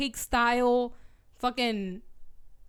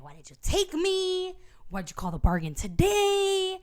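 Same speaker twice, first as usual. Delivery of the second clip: high, slow, drawn-out whine; pissed complaint; 7 semitones higher, slower than usual. Acted. Feyre demanding answers from Reese, pissed and whiny. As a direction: stretch each question in mock outrage. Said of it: "girl's pissed".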